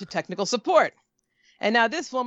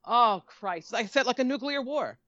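normal talking voice

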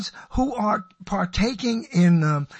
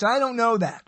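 normal talking voice

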